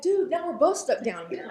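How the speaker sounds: man in the hole laughing as his friend jumps in; laughing punchline. Acted. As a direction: deliver it cracking up. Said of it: "and he's like".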